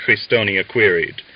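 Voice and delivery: plain reading voice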